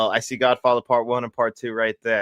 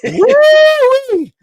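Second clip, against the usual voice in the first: very high, loud "woo-wee"; hammed-up whoop; 12 dB louder than usual. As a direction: let out a piercing mock-excited whoop. Whoop inside the movie quote.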